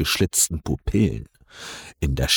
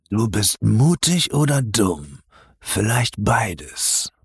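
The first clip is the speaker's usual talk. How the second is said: slow, lazy reptilian ruler; a predator toying with its prey; deep guttural voice; 5 semitones higher, slower than usual. Acted. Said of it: "spricht schließlich mit tiefer, kehliger Stimme". (tiefe, kehlige Stimme)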